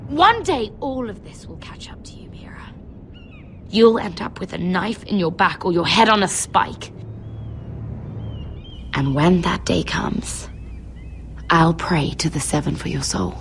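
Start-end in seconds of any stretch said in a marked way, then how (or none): 0.8-2.7 hushed, menacing whisper; whispered threat. Acted. 3.7-13.4 lower, cold ominous warning; grim low foreboding; low menacing. Acted. Dark prophecy after whisper.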